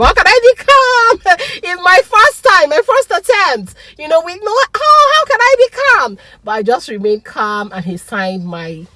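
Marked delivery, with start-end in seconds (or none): 0.0-6.2 high, loud, laughing disbelief; can't contain the joy; overjoyed laughing protest; ecstatic protest. Retold or imitated. Retold elated protest.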